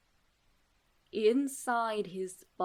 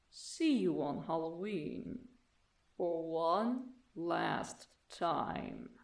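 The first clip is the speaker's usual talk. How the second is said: eerie hushed reading; 4 semitones lower, 6 dB quieter, much slower than usual. Acted. Eerie message read from door.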